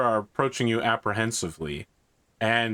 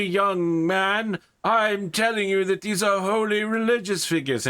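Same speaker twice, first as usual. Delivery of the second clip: put-on old priest, much higher and slow, scolding; 11 semitones higher, slower than usual. Priest berating a guard he raised, high outraged voice. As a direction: wag a finger like an outraged old vicar.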